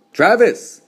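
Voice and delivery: loud, high, sharp reprimand; too sharp. A narrator's reading. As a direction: cut the boy off sharply by name. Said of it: "Mama's voice was too sharp".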